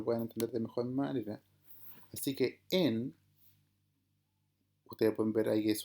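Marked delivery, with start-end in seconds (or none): 2.7-3.1 "en" said slowly with no h sound before the vowel; Greek smooth breathing. Shown as a demonstration. Teacher sounding out a Greek syllable for students, slow and clear. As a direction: open straight on the vowel in "en", no breath. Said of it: "el sonido de ambas juntas suena como en".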